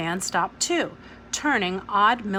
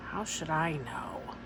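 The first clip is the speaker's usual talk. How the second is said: softer, sulky whisper; 3 semitones lower, 10 dB quieter than usual. Acted. Ada scowling at Miss Smith, whispering sulkily. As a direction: mutter it under your breath.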